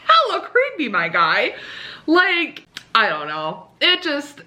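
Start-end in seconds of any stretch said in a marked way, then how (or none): 0.1-1.6 higher, laughing as she calls him creepy; amused disbelief; half laughing comeback; laughing retort. Retold or imitated. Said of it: "I'm like".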